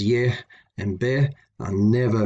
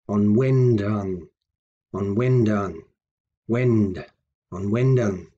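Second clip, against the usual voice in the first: on WENdan, weight on wend; stress in middle; slower than usual. Shown as a demonstration. Teacher demonstrating prefix before a verb, stress on wend. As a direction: keep on light and lean into wend. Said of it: "Notice the stress there in the middle".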